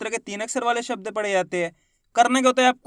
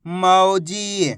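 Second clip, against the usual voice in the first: Mauji as one drawn-out word; syllables joined; much slower than usual. Shown as a demonstration. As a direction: blend मौ and जी without a pause. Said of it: "तो मिलके बन जाएगा".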